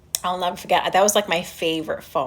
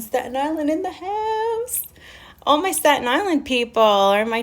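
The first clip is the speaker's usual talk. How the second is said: hometown shout-out; 6 semitones higher than usual. Real, not acted. Greeting Staten Island viewers in the chat, excited. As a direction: sing out the hometown pride, pitched high.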